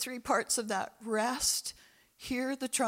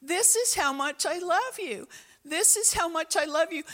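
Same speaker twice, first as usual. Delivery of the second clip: higher and louder, declaring love; resonating loud voice; 6 semitones higher, 3 dB louder than usual. Acted. God declaring his love to his people, resonant. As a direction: proclaim it like a heavenly declaration. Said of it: "this resonating, loud voice that said".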